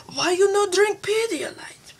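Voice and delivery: high, put-on scolding whine; hammed-up pidgin questioning; mock demanding voice. Mock meme voice demanding a reason.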